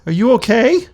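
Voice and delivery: put-on a small kid calling up with concern; worried child. Second village kid asking, high and loud. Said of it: "Another one says".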